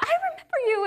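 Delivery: high, bright mock squeal of recognition; imitating her eager hello; delighted recognition. Tattoo shop girl greeting her a year later, high and excited. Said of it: "and she was like".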